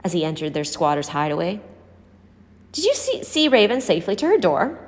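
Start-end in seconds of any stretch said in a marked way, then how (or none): 2.7-4.8 Bertie's voice pitched much higher; motherly old woman asking. A narrator's reading. Bertie checking Ben walked Raven home, high and fussing. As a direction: lift it up as a fussing old lady.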